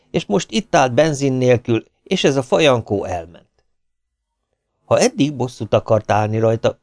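ordinary delivery

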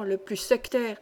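ordinary delivery, nothing marked

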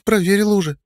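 put-on high dismissive girl's snort. A narrator's reading. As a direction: snort it off as a girl. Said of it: "фыркнула девушка".

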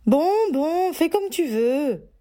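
high, grudging mother giving in; grudging grumble. Acted. Mother gives in to her daughter, grudging and high. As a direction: give in with bad grace. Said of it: "grommela-t-elle de mauvaise foi".

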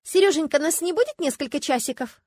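high, wheedling lilt; singsong coaxing. A narrator's reading. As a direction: sweet-talk him in a high singsong. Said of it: "запела Люська". (нараспев, заискивающе, высоко)

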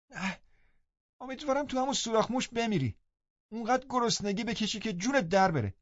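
put-on high, fast, petulant boy's curse; spiteful sick boy. A narrator's reading. Linton cursing the absent servant, high and spiteful. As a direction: spit it out like a spoiled invalid.

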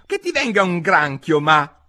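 put-on high, testy young voice; peeved. A narrator's reading. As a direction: talk back to mother crossly. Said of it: "disse la gamberina con una certa stizza".

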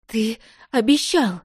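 slow tearful accusation; sobbing. A narrator's reading. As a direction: choke it out through sobs. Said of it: "Из горла вырвались рыдания".

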